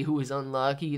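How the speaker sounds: ordinary talk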